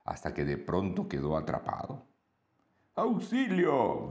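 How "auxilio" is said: agudo y lento, pidiendo ayuda; grito de auxilio. A narrator's reading. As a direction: clama auxilio atrapado. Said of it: "gritó". (grito agudo de auxilio)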